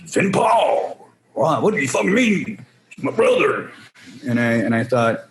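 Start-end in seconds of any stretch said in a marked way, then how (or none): 0.1-3.7 mock Daryl. Voicing Daryl on the phone.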